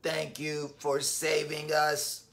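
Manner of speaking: low, slow robot voice. Acted. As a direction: thank them like a calm robot. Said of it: "in his robot voice".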